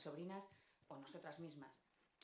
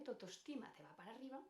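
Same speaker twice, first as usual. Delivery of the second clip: rising self-esteem; 7 semitones higher, faster than usual. Shown as a demonstration. Speaker showing how self-esteem climbs, high rising voice.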